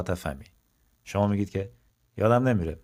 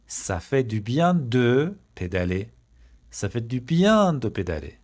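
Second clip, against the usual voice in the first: slow and deliberate, a bit louder; slow model sentence; 2 semitones higher, 4 dB louder, much slower than usual. Shown as a demonstration. Teacher repeats the answer slowly for students. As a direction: lay each word out for the class.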